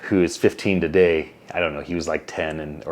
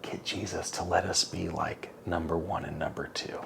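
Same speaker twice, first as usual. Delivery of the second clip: much softer, fading into a whisper; scheming sons whispering to mom; sneaky hushed request; 2 semitones lower, 15 dB quieter than usual. Retold or imitated. Sons asking mom for favour.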